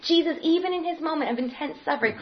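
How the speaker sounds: normal talking voice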